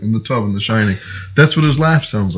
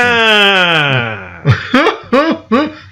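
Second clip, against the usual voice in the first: high odd cackle, imitating his laugh; imitated laugh; 7 semitones higher than usual. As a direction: copy a silly high laugh. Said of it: "Like this".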